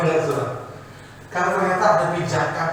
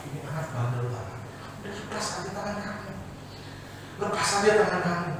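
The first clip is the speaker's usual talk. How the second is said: soft angel; 9 dB quieter, faster than usual. Acted. Angel scolds gently.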